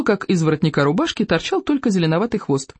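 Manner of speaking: usual speaking voice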